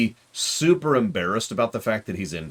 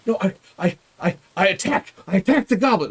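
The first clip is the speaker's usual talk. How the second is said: exuberant gamer; 10 semitones higher than usual. Retold or imitated. Haley jumping in mid-game, high and eager. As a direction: blurt the attack like a player swept up in the moment. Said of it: "really exuberant".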